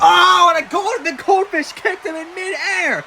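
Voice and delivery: excited live play-by-play; wrestling commentator. Acted. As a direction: call the action ringside.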